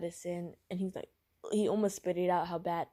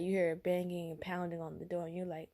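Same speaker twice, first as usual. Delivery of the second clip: ordinary delivery, nothing marked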